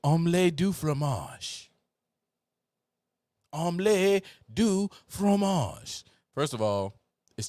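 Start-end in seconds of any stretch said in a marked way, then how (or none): 0.0-1.7 repeated louder in a mock French accent. Answering his friend, a louder mock French phrase. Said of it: "What does that mean?". 3.5-6.0 loud, high, slow mock French; hammed-up French. Answering his friend, a hammed-up high, loud mock French phrase. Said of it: "What does that mean?".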